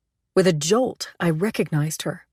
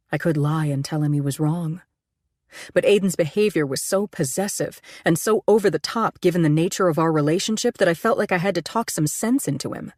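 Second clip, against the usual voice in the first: ordinary delivery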